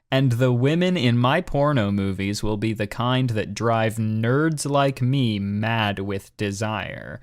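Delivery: usual speaking voice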